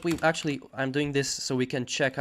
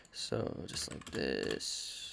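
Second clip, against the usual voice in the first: whispered aside; 11 semitones lower, 12 dB quieter, much slower than usual. Real, not acted. Low aside while demonstrating code.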